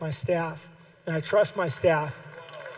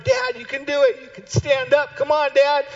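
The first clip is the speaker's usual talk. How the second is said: put-on higher, encouraging kid cheer; 7 semitones higher, 5 dB louder than usual. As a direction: cheer Dad on like his kids. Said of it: "I'm like".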